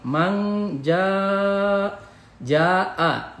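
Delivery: "Mang ja" with "ja" stretched long; long mad. Shown as a demonstration. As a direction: chant "ja" high and held for five beats. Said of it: "mad wajib mutasil dibaca lima harokat".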